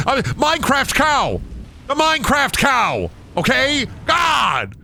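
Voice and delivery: outraged disbelief at a chat answer; shocked outcry. Real, not acted. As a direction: yell it out in stunned disbelief. Shocked by the Minecraft cow answer.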